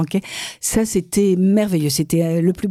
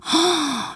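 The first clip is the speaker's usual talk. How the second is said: delighted gasp; 10 semitones higher, 3 dB quieter than usual. Retold or imitated. Recalling puppy surprise gift.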